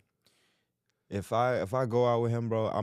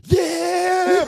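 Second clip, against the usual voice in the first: loud, high hype shout; hype yell; 9 dB louder than usual. Retold or imitated. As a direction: hype him up from the back seat. Brother hyping him from back seat.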